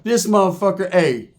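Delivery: normal talking voice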